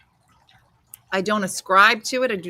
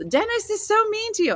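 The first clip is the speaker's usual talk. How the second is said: much higher whiny imitation of fans; mock concerned listeners. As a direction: mimic fussing listeners in a high voice. Imitating worried listeners' complaint.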